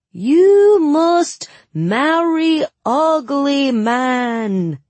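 high, loud, very slow fake Suli accent; awful Suli accent. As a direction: ham up a dreadful Suli accent. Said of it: "My accent was even worse".

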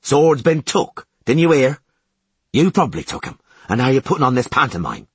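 bully him out the door; brutish shouting guard. Acted. Commandant yelling.

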